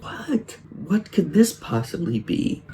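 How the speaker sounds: high-pitched, put-on bafflement; mock surprise. Acting Victor's clueless surprise at screaming.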